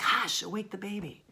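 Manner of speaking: high, urgent mock parental hush; forceful hush. Shown as a demonstration. Teacher imitating parents who got the baby to sleep, forceful hushing. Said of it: "They're like".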